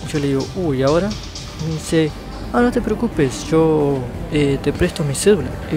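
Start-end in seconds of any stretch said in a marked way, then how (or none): none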